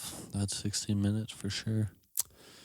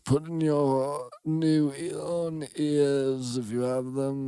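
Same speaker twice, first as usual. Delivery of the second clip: hammed-up old man slipping British; 9 semitones higher, 5 dB louder than usual. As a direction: croak it out like an ancient host. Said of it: "You sound more like a British guy".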